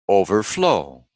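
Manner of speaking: overflow with FLOW stressed; overflow, weight on the final syllable; stress on second part. Shown as a demonstration. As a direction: rise onto FLOW in overflow, then fall. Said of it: "always the second word".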